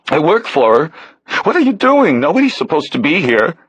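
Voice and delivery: put-on high, pleading young man's voice; a rattled stranger begging to be let go; nervous young man. Realtor's helper pleading with the man who grabbed him, nervous.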